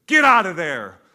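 loud sharp command to a horse; shouted command. Retold or imitated. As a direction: yell it at the stuck horse. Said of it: "and says".

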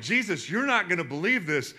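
loud, high, thrilled report; excited disciples. Retold or imitated. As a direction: tell him like you can't believe it. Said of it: "They're like".

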